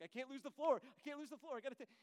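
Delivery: high, loud desperate rush; cling to the audience in a mock panic; panicky. Nervous speaker trying to hold middle schoolers, mock panic.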